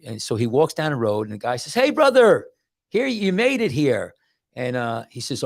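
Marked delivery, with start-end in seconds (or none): 1.7-4.1 high, loud mock greeter calling out; hailing welcome. Gatekeeper in a retold story greets the dead traveller, hailing welcome. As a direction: act out an eager gatekeeper's hail.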